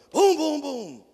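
loud booming knock sounds; imitated door pounding. Dean mimicking the student pounding on his door. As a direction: bang it out like fists on wood.

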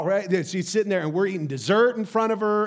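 ordinary delivery

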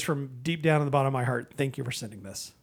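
ordinary talk, nothing marked

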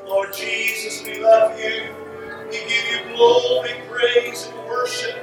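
normal talking voice

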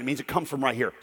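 ordinary talk, nothing marked

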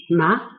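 ma sweeping upward from mid pitch; rising second tone. Shown as a demonstration. Teacher repeating the second tone, rising. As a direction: raise ma to the top of the voice. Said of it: "It's a rising tone".

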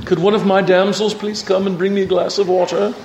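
lightly put-on grand lady asking for water; mock royal request. Preacher teasing the congregation, a light put-on grand voice. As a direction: playfully summon a damsel. Pretend calling for a damsel.

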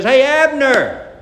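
mock shout down from the hillside; preacher hollering across a valley. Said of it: "David shouts down".

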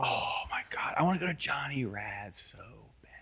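soft longing whisper. Real, not acted. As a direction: breathe it out softly.

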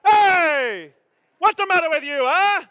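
irate New Jersey shout, high and loud; New Jersey accent. Acted. Driver yelling back at a honker, New Jersey accent. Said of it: "I get a New Jersey accent".

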